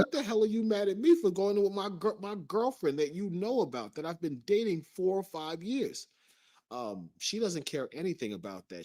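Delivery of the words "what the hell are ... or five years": mock outrage. Angry retelling of her rant.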